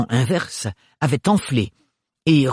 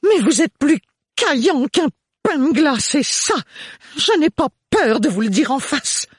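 much higher and louder outburst; exploding mother; 3 dB louder than usual. A narrator's reading. The mother exploding at Thorne, much higher and louder. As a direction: boil over at him.

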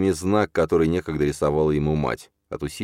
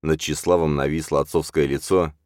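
usual speaking voice

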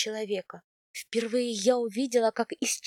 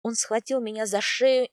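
ordinary delivery, nothing marked

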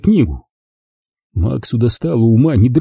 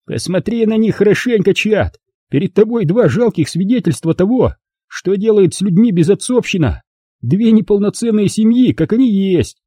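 high, contemptuous public jeer; gloating bully Rob; 9 semitones higher than usual. A narrator's reading. Rob mocking the fatherless boys to Chad, high and scornful. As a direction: show them off to Chad with scorn.

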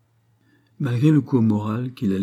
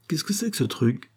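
higher-pitched, curious query; puzzled question; 6 semitones higher than usual. A narrator's reading. Acted question in narrated dialogue.

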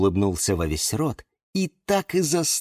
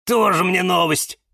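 higher, huffy retort; scornful snort; 8 semitones higher than usual. A narrator's reading. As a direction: scoff at stale news. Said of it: "фыркнул кактус Кирилл". (насмешливо фыркнуть)

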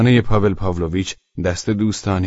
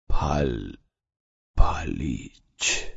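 hoarse voice; 6 semitones lower, 3 dB quieter, much slower than usual. A narrator's reading.

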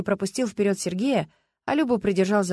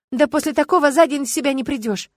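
higher, louder indignant protest; 6 semitones higher, 7 dB louder than usual. A narrator's reading. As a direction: object with open indignation. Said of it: "возмутилась Людмила".

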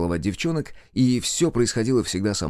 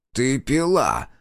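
father's accusing growl, a bit raised; angry growl; 5 semitones higher, much slower than usual. Acted. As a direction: growl the accusation, somewhat higher. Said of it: "прорычал Анатолий Викторович". (сердитый отцовский рык, чуть повышенный тон)

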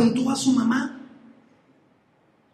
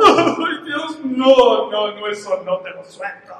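mock wailing; 3 dB louder, slower than usual. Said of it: "¿Se puso a llorar?".

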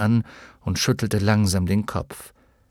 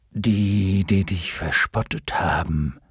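dignified luck dragon. Acted. (würdevoller Glücksdrache, tiefe Stimme)

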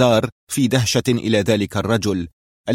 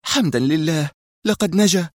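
higher female voice, relieved sigh; relieved Mona; 7 semitones higher than usual. A narrator's reading. Mona relieved that Adham survived, higher voice. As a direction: breathe out in relief. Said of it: "تنفست الصعداء وهي تقول".

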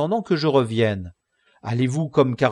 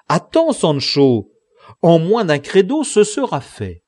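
loud, raised call to hold on; exclaiming; 4 semitones higher, 7 dB louder than usual. A narrator's reading. Don Quichotte calling Sancho back, loud exclamation. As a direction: call out urgently, high and strong. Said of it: "s'écria Don Quichotte".

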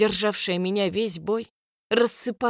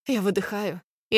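ordinary delivery, nothing marked

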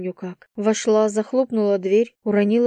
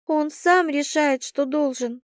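ordinary delivery

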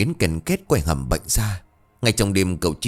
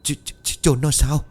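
stuttering in terror then a higher question; frightened wife asking about burying the body, stammering; trembling stammer; 6 semitones higher than usual. A narrator's reading. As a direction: stammer out of fear. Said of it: "Bằng một giọng run rẩy, Thị lắp bắp".

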